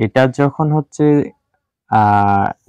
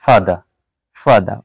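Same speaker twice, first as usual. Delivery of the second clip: British "ফাদার" with final r dropped; British non-rhotic "father", open ending; British accent; 3 semitones lower than usual. Shown as a demonstration. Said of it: "British অ্যাকসেন্টে বলতে চাই".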